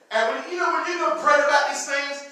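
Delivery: normal talking voice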